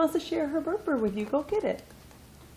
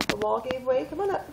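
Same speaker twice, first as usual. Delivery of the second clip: ordinary talk, nothing marked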